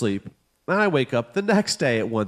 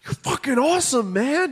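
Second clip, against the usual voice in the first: excited praise; 12 semitones higher than usual. Retold or imitated. Dad praising daughter's work.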